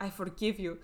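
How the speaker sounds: lightly imitated Russian accent. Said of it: "Are you gonna do the Russian accent?".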